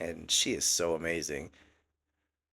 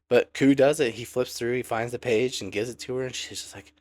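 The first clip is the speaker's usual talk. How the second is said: normal talking voice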